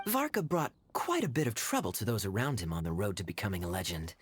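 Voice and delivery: normal talking voice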